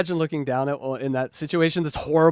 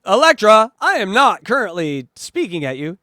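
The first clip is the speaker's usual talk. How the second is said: loud, pointed, overly formal; loud mock announcement; 5 semitones higher, 8 dB louder than usual. Real, not acted. Mock formal address to Electra.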